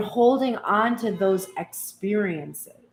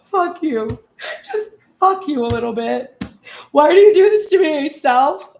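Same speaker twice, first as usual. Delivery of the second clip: higher, louder half-crying mock rage; mock outburst at self; 7 semitones higher, 8 dB louder than usual. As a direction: rail at yourself in comic despair. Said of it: "be like".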